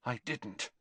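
barely voiced, very soft denial; breathed. A narrator's reading. Malfoy denying he invited Greyback, breathed. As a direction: breathe it out softly. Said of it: "breathed Malfoy".

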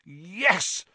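high, exultant cry; excited yell. A narrator's reading. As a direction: cheer it out in triumph. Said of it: "Ron yelled".

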